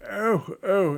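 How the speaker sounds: high startled oh oh; mock alarm. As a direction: gasp it out, caught off guard. Juliet startled by plan.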